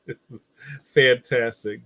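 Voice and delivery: usual speaking voice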